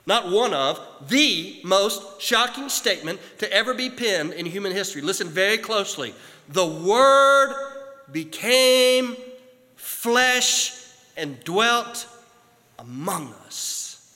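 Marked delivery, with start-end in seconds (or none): 6.5-14.1 solemn preacher quoting scripture phrase by phrase; scripture proclamation. Acted. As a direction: proclaim each phrase with long pauses, then let it fall away. Proclaims scripture after "Listen very closely.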